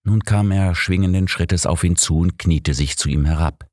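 plain reading voice

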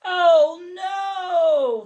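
high, loud wail of despair; Mister Bear's loud, high, exasperated wail; exasperated cry. Acted. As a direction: cry out like a fed-up bear.

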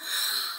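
soft gasp of astonishment. A narrator's reading. Everyone sees the messenger unmasked, gasping. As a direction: draw in an astonished breath. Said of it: "Everyone in the room gasped".

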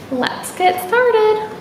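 plain reading voice

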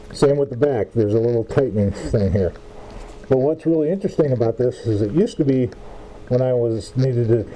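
muffled behind face mask; speaking inside rubber respirator; through a respirator mask. Real, not acted. Seen 0: Cartridge respirator covers nose and mouth throughout the labelled words.

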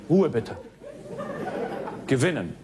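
a hint of a strict teacher; quiz show host. Game show host quiz read.

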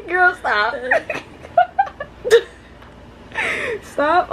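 high, giggling protest; laughing at the sister's teasing "aw". Real, not acted. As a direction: laugh it off, embarrassed.

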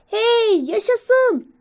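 put-on high, loud childlike cheer; excited shout. Young squirrel seeing snow outside, joyful high shout. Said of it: "diye bağırıyor".

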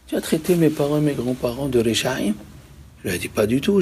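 usual speaking voice

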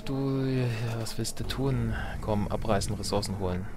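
ordinary delivery

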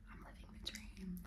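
hushed, breathy self-whisper. Acted. Whispering to myself every night, whispered. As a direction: whisper it privately, very soft. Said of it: "whisper to myself every night".

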